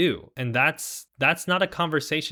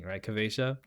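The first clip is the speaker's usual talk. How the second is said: usual speaking voice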